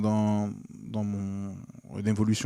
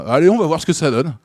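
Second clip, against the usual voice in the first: louder, higher, breezy let's-try-it voice; carefree quote; 6 semitones higher, 10 dB louder than usual. Retold or imitated. A comedian recalling his early mindset, quoting himself breezily. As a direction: toss it off like a shrug, louder and higher.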